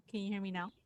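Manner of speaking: faint voice, a little better; slightly faint. Real, not acted. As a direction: still faint but a bit clearer. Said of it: "It's a little bit better, but not much".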